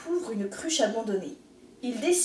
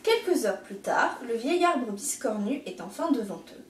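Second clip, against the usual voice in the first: usual speaking voice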